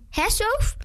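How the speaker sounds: high, whining appeal; put-on whiny little question. A narrator's reading. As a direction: seek agreement in a high whine. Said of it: "met een zorgige stem".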